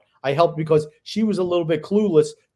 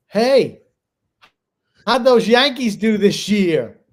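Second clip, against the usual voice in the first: loud, higher, drawn-out heckle; mock taunt; 6 semitones higher, 7 dB louder, slower than usual. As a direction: call it out to needle a young fan. Old woman taunting a child, retold.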